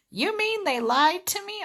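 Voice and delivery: high-pitched imitation of an upset caller. Crying customer to the phone rep, imitated high and upset. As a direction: mimic a customer's high, hurt disbelief. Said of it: "she started crying".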